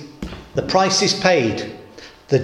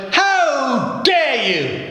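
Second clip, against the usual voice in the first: high, drawn-out indignant exclamation in a posh voice; outraged posh lady; 9 semitones higher, much slower than usual. Retold or imitated. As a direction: mimic an appalled posh lady, stretching each word. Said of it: "Very posh lady".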